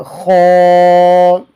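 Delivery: a thick, emphatic خا; خا recited heavy as a Qur'an reading teacher would; heavy kha. Shown as a demonstration. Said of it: "اس کو موٹا کر کے پڑھنا ہے".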